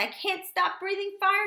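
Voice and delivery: a high, loud panicked cry. Acted. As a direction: blurt it out in rising panic. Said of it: "Crispin's father panicked".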